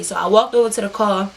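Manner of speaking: normal talking voice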